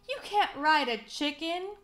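a touch of storybook disbelief; mild protesting cry. A narrator's reading. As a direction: cry out at a silly choice. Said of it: "cried Lasso Lou".

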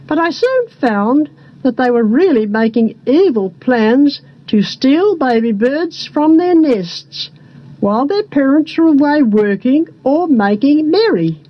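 plain reading voice

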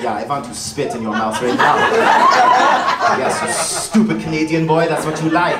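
lightly mimicked local girl; imitating audience girl. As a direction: play an eager Austrian woman, lightly. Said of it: "This girl's like".

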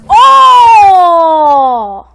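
drawn-out loud "oh", falling in pitch; long anguished yell. Real, not acted. Player dying just short of victory, anguished yell. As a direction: wail it out as you lose.

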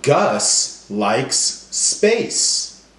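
very slow, each word held; slow, stretched sentence. Shown as a demonstration. Teacher modelling end sounds slowly to class. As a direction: lean on every final s. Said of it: "every word's gonna end with the same sound".

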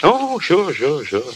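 a hasty, high-pitched "Oh, sure" that slides into mumbled agreement; quick agreement that sounds forced; too quick. Acted. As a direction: rush the agreement so it rings hollow. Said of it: "That was a little too quick".

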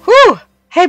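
normal talking voice

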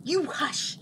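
mildly exasperated silencing; sharp shushing. Acted. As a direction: cut him off crossly. Cutting off her companion.

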